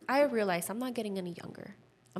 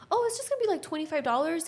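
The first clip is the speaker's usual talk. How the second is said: much higher, bright customer-service voice; breezy office voice; 8 semitones higher, 4 dB louder, much faster than usual. Retold or imitated. Imitating the apartment office clerk on the phone, high and chirpy.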